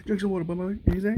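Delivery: loud, teasing call to drink water; loud joking advice. Real, not acted. Telling the alligator to hydrate, shouted teasingly.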